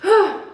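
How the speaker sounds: big exhale after the stairs; heavy winded sigh; breathless sigh. Real, not acted. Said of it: "I'm out of breath from the steps".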